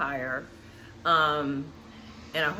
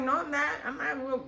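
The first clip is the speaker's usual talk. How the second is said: retold protest; 7 semitones higher than usual. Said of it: "Candace is like".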